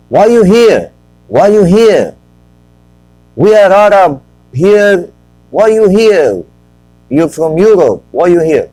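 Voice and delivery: high, loud, indignant accusing shout; shouting locals. Retold or imitated. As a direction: yell it like a suspicious local confronting a stranger.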